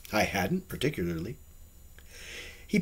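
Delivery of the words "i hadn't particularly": ordinary delivery, nothing marked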